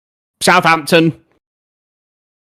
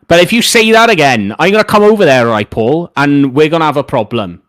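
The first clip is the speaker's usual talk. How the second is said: louder, hammed-up threat to a viewer; mock angry threat; 5 dB louder than usual. Streamer mock-threatens a chat viewer, hammed-up angry. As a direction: play the hard man, half-joking.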